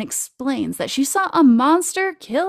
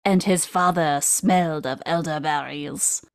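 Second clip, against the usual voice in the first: lower, badly faked Monty Python French accent; 4 semitones lower than usual. Host quoting Monty Python taunt, poor French accent. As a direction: attempt a hint of silly mock-French accent. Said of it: "I cannot do the Monty Python French accent".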